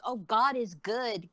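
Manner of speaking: slow, lightly voiced churchy mother; mock pious mother. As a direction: drawl it like a sanctimonious churchgoer.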